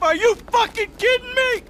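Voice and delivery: an outraged husband confronting the man who betrayed him; incredulous outrage. Acted. Furious at friend who slept with wife.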